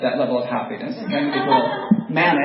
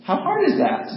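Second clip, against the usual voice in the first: exasperated squeak. Retold or imitated. Exasperated boss.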